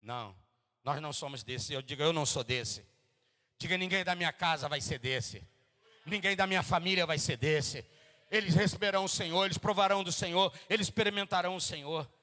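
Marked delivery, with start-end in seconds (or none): 1.7-12.1 loud, building proclamation, quickening; rising pulpit declaration; preaching declaration. Acted. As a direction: proclaim it over the whole house. Declaring faith over family.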